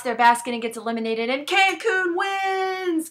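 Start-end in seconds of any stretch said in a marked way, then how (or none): 1.3-3.1 high, slow triumphant shout; excited. Real, not acted. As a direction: cheer the victory like an announcer. Excited announcement.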